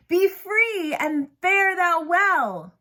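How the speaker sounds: high, slow, grand stage release; grand Prospero. Acted. Teacher playing Prospero freeing Ariel, grand and slow. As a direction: bestow freedom like a magician. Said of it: "like Prospero himself had come".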